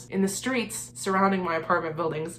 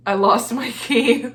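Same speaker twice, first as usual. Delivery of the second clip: slow, louder, higher, through laughter; laughing confession; 4 semitones higher, 6 dB louder, slower than usual. Retold or imitated. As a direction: admit it while cracking up. Laughing while retelling her confession.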